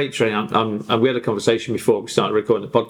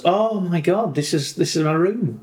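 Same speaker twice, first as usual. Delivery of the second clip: higher-pitched dog discovering its own bedroom; voiced delighted dog; 6 semitones higher, slower than usual. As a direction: imitate an amazed dog, pitched up. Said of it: "and the dog goes".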